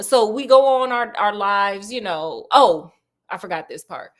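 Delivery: ordinary delivery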